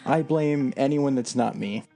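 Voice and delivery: normal talking voice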